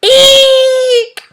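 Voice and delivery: piercing high screech at full volume; loud owl screech. A narrator's reading. Owl screeching to scare the squirrel, piercing and loud. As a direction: shriek it out like a startled owl. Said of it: "let out his very loudest".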